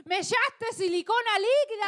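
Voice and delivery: high-pitched outraged shriek; acted scream. Woman accuses her lover of the mistake, acted scream. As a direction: scream the accusation in mock horror. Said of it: "Grité y le dije".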